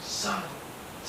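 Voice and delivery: a slow, soft, trailing-off curse; slow muttered curse. Retold or imitated. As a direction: drag out the curse slowly and softly. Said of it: "I'm like".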